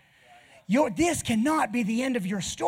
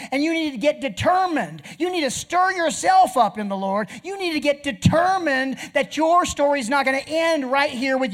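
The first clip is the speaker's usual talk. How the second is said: louder, higher fiery exhortation; preaching at full force; 8 semitones higher, 7 dB louder than usual. Acted. Preacher urges congregation to keep moving, fiery and loud. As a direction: drive the congregation forward.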